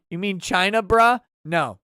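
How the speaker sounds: usual speaking voice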